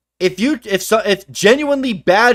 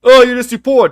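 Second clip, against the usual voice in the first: louder, higher sneering put-down; mock snooty fanboy; 5 semitones higher, 7 dB louder than usual. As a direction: sneer it like a smug console fan. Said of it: "what the fuck are we doing here".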